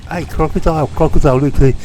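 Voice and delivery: high-pitched mock Brummie, calling out; high, mocking West Midlands call; mocking Birmingham accent. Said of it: "mocking Midlands Birmingham accent".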